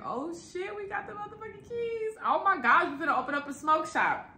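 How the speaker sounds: high-pitched excited celebration. Retold or imitated. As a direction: squeal it out, thrilled to get the keys. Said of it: "I'm excited".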